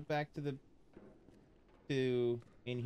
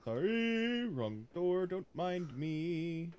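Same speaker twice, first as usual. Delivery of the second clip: mock polite voice; 6 semitones higher, much slower than usual. Playful apology to game character.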